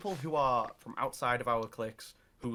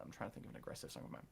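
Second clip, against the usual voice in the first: fast, much softer whispered aside to himself; 13 dB quieter, faster than usual. Real, not acted. Rapper searching for a song title, quick whispered aside. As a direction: drop it to a quick whisper while searching memory. Thinking aloud mid-answer.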